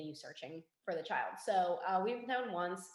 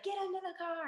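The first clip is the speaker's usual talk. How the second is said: very high mock yell at normal volume; urgent, high-pitched imitation shout; retold scream; 12 semitones higher than usual. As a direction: imitate a screamed order without full volume. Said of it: "he's screaming".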